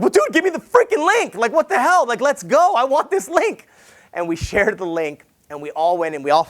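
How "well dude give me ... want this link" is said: high, loud, pushy mock plea; impatient demand. Retelling to the audience how he begged his buddy for the link, high and pushy. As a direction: beg for the link like you can't wait.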